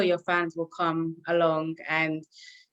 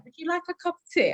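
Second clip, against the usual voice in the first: mock British accent; 10 semitones higher, faster than usual. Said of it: "A lot of people do that".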